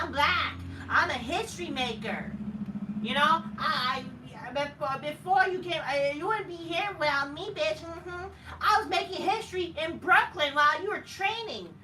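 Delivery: high loud mock bragging; mocking Sasha Banks. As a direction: boast like a smug wrestler. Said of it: "That's what Sasha Banks basically said".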